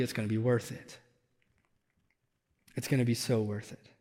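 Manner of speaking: hushed sincere assurance; soft reassurance. Real, not acted. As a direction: lean in and promise it gently. Promise after hard times.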